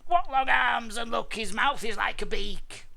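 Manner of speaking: loud, high-pitched grumble; appalled mother dinosaur; grumbling. A narrator's reading. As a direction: complain loudly about the baby. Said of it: "she grumbled".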